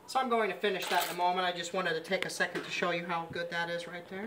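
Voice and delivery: usual speaking voice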